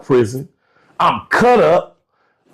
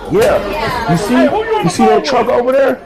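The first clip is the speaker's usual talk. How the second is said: higher-pitched retold boast; imitated proud coworker; 9 semitones higher than usual. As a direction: voice an old-timer showing off, pitched up. Voicing coworker showing off his truck.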